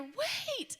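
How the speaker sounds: high call to stop someone. Retold or imitated. Greeter calling after the departing family, high urgent call. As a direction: call after them before they leave.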